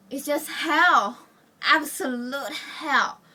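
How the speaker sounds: HELL drawn out slow and loud; emphatic dread. Acted. Telling how two days indoors feels, anguished emphasis. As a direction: lean hard and slowly on "hell".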